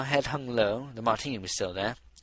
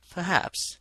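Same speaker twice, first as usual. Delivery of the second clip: thoughtful; 7 semitones higher than usual. A narrator's reading. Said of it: "she said thoughtfully".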